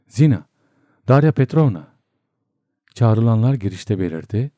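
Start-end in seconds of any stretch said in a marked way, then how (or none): 0.1-1.9 loud, raised summons; calling out loudly. A narrator's reading. Doctor summons the two women, shouting. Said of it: "ve bağırdı".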